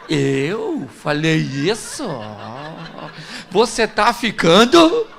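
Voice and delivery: slow, drawn-out mock innocence; cynical denial. Husband denies it to his wife, brazenly cynical. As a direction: feign disbelief, stretching the words out. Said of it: "com a cara mais cínica do mundo e diz".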